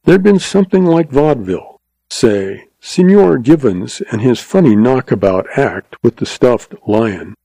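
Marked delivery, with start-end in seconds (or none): none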